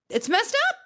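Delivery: high, climbing cry of disbelief; almost a yelp of outrage, way up high; outraged disbelief. Real, not acted. Viewer outraged for Dorit, disbelieving high cry.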